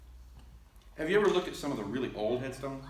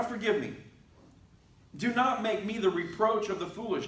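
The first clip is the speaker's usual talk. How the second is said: David's prayer; 6 semitones higher than usual. Acted. Voicing David's frustrated prayer.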